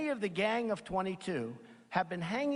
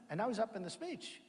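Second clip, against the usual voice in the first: normal talking voice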